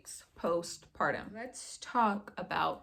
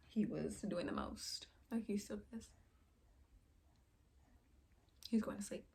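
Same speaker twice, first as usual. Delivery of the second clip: whispering; 10 dB quieter than usual. Real, not acted. Baby falling asleep nearby.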